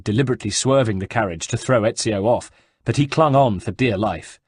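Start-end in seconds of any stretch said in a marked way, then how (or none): none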